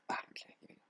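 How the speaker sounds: whispered cue to self; whispering. Real, not acted. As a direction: breathe the cue under breath. Whispered cue before take.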